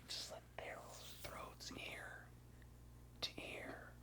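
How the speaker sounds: hushed whisper, slow. Real, not acted. Describing the killer's throat slash in a whisper. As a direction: whisper the gruesome part. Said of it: "whispered reveal".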